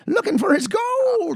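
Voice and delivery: high, slow leprechaun voice; lightly voiced sneaky leprechaun. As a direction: creep it out like a leprechaun. Leprechaun joke voice.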